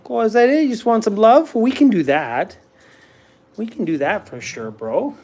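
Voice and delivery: affectionate to the dog. Real, not acted. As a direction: gush over the dog that wants love.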